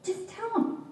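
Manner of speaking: high-pitched, pressed plea; hissed urging. A narrator's reading. Sister urging her sibling to give in, acted high hiss. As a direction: urge it as a sister's hissed order. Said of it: "my sister hissed".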